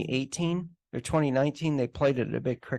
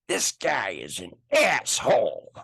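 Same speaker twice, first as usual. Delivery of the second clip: put-on high, harsh drunken outburst; drunk angry Scottish woman; 8 semitones higher, 3 dB louder than usual. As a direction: spit it out like a furious drunk. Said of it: "She got more and more violent".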